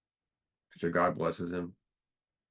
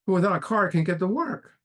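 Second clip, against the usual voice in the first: much higher and louder pleading protest; desperate pleading; 11 semitones higher, 6 dB louder than usual. Retold or imitated. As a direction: beg as if everything is slipping away. Drunk pleads with God.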